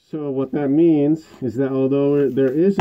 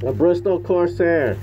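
louder and higher, stress on Bristol; repeated louder; 7 semitones higher, 3 dB louder than usual. Real, not acted. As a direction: call out "Bristol" loud and clear over the mask. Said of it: "Can you hear me through this mask thing?".

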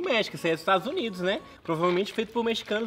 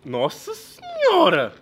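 shocked exclamation; 9 semitones higher, 7 dB louder, much slower than usual. Real, not acted. Reacting to the candy's look.

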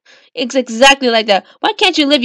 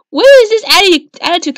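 startled protest; 6 semitones higher, 4 dB louder than usual. Acted. Acted quarrel, startled protest.

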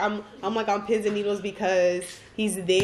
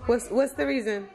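normal talking voice

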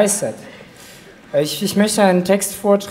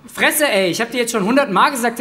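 loud, higher, fast outburst; irritated father; 6 semitones higher, 4 dB louder than usual. Retold or imitated. Father snapping at his son for calling him Mama, loud. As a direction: bark it out fast at your son. Father snaps at son's story.

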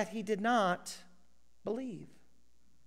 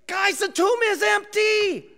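higher, louder, out of breath; breathless proclaiming; 11 semitones higher, 7 dB louder than usual. Retold or imitated. Peter and John bursting in on Thomas, breathless and excited. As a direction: burst in with the news, gasping.

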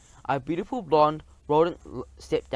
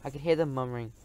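ordinary delivery

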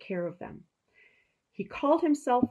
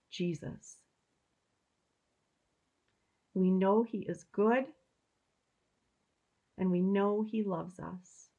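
prayer. Real, not acted. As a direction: pray it gently with long pauses. Leads a prayer.

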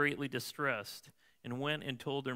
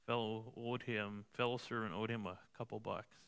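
usual speaking voice

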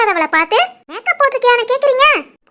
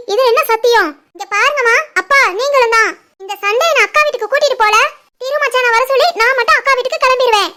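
ordinary talk

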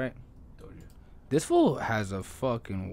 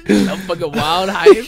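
laughing hype; 10 semitones higher, 14 dB louder, slower than usual. Retold or imitated. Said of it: "And I was like".

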